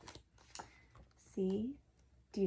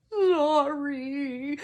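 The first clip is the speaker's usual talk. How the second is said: higher, louder sobbing apology; 7 semitones higher, 10 dB louder than usual. Acted. Hagrid apologising to McGonagall, sobbing. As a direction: blurt it through tears. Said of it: "sobbed Hagrid".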